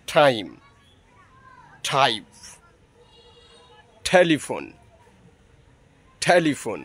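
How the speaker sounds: টাইম and টেলিফোন said with an aspirated ঠ sound at the start. Shown as a demonstration. Teacher giving students example words, aspirated ঠ at the start. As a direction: breathe out hard on the first t of টাইম, টাইপ, টেলিফোন. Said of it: "ঠ এর মতো".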